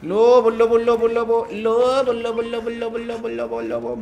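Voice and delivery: llamada aguda y fuerte, cantarina; llama al lobo en voz alta como a una mascota; llamando al lobo. Real, not acted. Calling the wolf to befriend it. (llamando al lobo en voz alta y aguda para hacerse su amigo)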